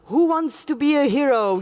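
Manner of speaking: ordinary delivery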